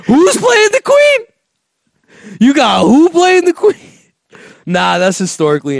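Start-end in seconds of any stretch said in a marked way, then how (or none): 0.1-3.8 very high, loud screech; drawn-out falsetto squawk of fake outrage; mock outraged shriek. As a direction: shriek it in exaggerated disbelief. Mock outrage over casting, shrieked.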